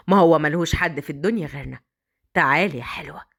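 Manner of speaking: low, cold scheming; callous villain. Acted. Villain reveals plan. (صوت شرير بارد يخطط)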